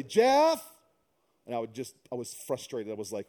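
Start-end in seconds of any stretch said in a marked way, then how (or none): 0.0-0.6 high, loud call from downstairs; imitating a far-off shout of his name. Wife calling her husband from downstairs, loud high call. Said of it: "my wife from the bottom of the house say".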